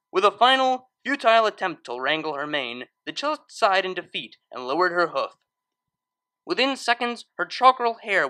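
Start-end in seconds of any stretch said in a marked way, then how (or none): none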